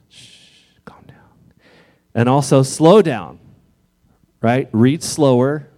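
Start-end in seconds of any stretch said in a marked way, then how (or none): 0.1-1.2 soft unvoiced shush and whisper. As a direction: hush yourself like calming a scared child. Hushing own anxious self.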